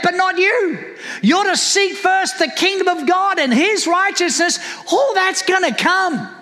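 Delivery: high-pitched, driving sermon delivery; emphatic, exhorting preacher; impassioned preaching. Real, not acted. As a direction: preach it with rising urgency to the congregation. Preaches at full force.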